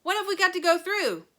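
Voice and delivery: loud, high question; alarmed shout. Acted. Grandpa Joe alarmed.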